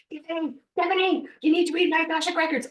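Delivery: high, loud, fast frantic squeal; shrieky freak-out, loud and high. Retold or imitated. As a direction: re-enact a frantic, urgent phone plea. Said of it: "I'm freaking out".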